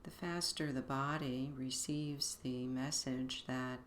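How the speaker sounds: guided meditation. Acted. Begins leading the meditation.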